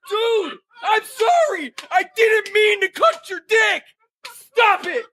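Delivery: high-pitched, frantic shouted apology, acted out; pleading yell. Man trapped in closet apologizing to his attacker, high pleading yell.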